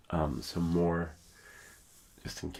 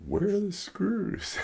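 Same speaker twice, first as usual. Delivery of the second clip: mock panic; 6 dB louder, slower than usual. Worried about losing screws.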